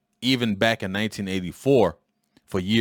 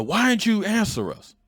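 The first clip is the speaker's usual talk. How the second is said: high, pressing father's question; father confronting his son in the store; retold angry demand; 12 semitones higher than usual. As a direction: demand an answer from the boy. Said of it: "And he was like".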